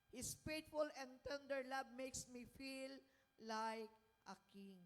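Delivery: slow, deliberate Bible reading to the congregation; slow scripture reading. Acted. Preacher reads the psalm aloud to the congregation, slow and deliberate. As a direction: read each verse slowly, letting it land.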